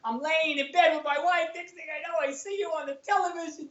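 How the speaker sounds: high-pitched imitation of an amazed buddy; excited friend. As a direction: play a friend phoning with big news. Friends phoning after seeing him on TV.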